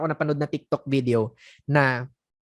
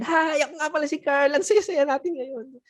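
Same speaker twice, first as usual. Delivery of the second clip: much higher, bubbly over-eager hello; overexcited greeting; 10 semitones higher than usual. Shown as a demonstration. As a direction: gush like an overeager agent. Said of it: "Pero pag excited".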